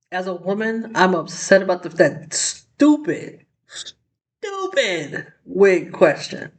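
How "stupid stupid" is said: "stupid, stupid" dragged out very slowly; exasperated emphasis. Real, not acted. Commentator fed up with the lawyer's wig question, exasperated drawl. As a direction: stretch each "stupid" in exasperation.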